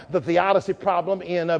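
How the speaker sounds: ordinary talk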